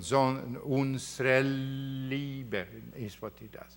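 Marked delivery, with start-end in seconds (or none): 0.7-2.7 "unsere" drawn out before "Liebe"; lengthened upbeat. Shown as a demonstration. Lecturer shows how the singer delays the stressed word, lengthened upbeat. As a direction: hold the last syllable of "unsere", delaying "Liebe". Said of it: "the upbeat tone".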